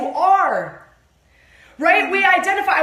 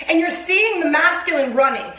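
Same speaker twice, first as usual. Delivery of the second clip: usual speaking voice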